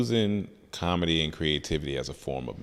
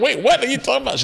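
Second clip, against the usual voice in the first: much higher, fast, louder disbelief as his mom; mom's shocked reply; 5 dB louder, faster than usual. Retold or imitated. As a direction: play his mom stunned on the phone, pitched way up and rushing. Mom voiced in phone story.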